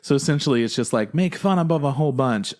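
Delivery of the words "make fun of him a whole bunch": mock summary. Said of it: "mocking show formula".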